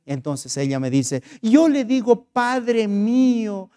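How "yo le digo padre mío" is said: higher, drawn-out plea; acted supplication. Hotel owner shows the pastor how she prays, imploring. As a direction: imitate someone begging in prayer, stretching the words. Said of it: "cómo suplicas a Él".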